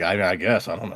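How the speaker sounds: low, soft, unsure mumbling; imagine a hesitant, clueless listener giving an opinion; noncommittal shrug. Pretend. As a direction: drop low and quiet, doubtful. Unsure listeners' hypothetical reply.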